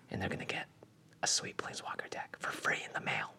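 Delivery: soft secretive whisper. Acted. Whispering the prize reveal.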